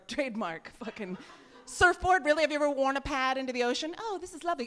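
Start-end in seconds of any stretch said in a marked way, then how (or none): 4.0-4.7 delighted swimmer. Acted. Acted pad wearer enjoying the ocean.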